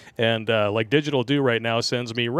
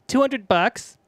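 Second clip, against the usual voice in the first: high stripper voice; 12 semitones higher, slower than usual. Acted.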